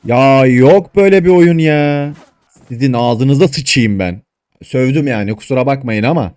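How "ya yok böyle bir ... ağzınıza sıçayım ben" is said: louder, slow, furious; exasperated rage. Real, not acted. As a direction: let the frustration boil over. Raging at the game. (öfkeli, yavaş patlama)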